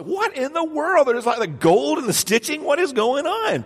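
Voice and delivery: loud, high-pitched mock bewilderment; acted exasperated disbelief. Customer baffled by pricey pants, exasperated disbelief. Acting out shock at costly pants.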